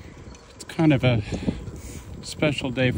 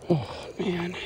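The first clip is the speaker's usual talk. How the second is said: choked up; 8 semitones higher, 7 dB quieter than usual. Real, not acted. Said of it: "I'm starting to cry".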